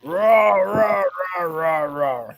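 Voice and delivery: raspy rah rah call, fading away; raspy mimic call. Telling a friend about a Sasquatch call, mimicking it raspily. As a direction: imitate a raspy coyote-like call. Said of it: "this real raspy".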